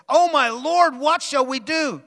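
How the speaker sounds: high, loud panicked cry; frightened servant. Acted. Servant crying to Elisha at the army, high panicked. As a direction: cry out in alarm. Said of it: "The servant asked".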